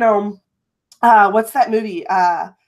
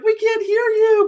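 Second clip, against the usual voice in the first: high, urgent quoted viewer; viewer's warning; 13 semitones higher than usual. Retold or imitated. Viewer calls to warn the host, high urgent voice. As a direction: squeak out the viewer's alarm. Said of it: "was like".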